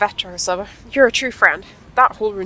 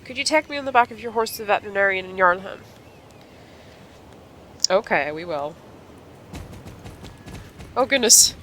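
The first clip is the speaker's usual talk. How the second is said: ordinary talk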